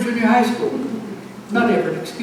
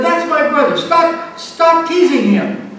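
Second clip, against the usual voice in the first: higher, louder shout at the bully; retold yell; 7 semitones higher, 4 dB louder, slower than usual. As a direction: yell across the street to stop them, slowly. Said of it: "I yelled".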